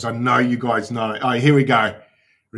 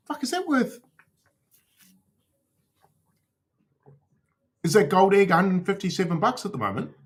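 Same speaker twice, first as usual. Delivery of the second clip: shocked disbelief; 8 semitones higher than usual. Real, not acted. Shocked at an NFT price.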